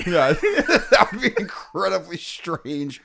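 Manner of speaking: high, loud, slow words through laughter; amused, laughter-filled outburst; real laughing. Real, not acted. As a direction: crack up while agreeing. Laughing at the joked-up channel idea.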